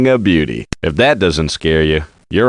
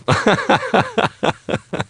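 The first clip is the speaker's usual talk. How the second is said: high cackling gloat laugh; high mocking cackle; 4 dB quieter than usual. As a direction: cackle over the winnings. Gloating after winning.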